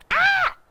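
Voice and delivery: sudden high yelp of fright; startled scream. Pretend. Person at the back door, screaming in fright. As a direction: shriek like you just saw a possum. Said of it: "and you go".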